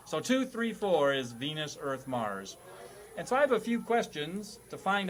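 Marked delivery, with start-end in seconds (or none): none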